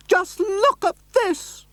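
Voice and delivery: a high lady shouting up from below; excited high-voiced neighbour calling; shrill excited lady. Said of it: "shrill with excitement".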